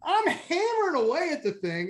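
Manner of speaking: animated rant. Real, not acted. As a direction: rant about your clumsy hands. Ranting about huge hands.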